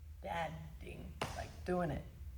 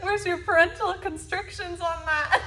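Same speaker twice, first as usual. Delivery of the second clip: high, laughing mock-classmate question; laughing quote; 9 semitones higher, 7 dB louder than usual. Daughter laughs through a classmate's question. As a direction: ask it while cracking up.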